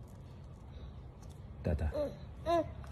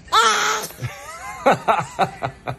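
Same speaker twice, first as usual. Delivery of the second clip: burst of laughter. Real, not acted. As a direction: crack up completely. Cracking up.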